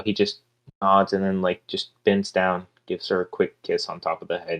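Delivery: ordinary talk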